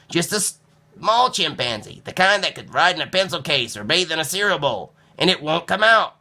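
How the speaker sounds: put-on high worried child explaining; young Nicholas. A narrator's reading. Nicholas voiced in story.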